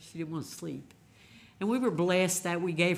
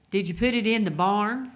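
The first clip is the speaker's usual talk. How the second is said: voicing his mother, louder and higher; a hint of his elderly mother, raised voice; mother's question; 5 semitones higher, 10 dB louder than usual. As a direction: call it out as the old mother would.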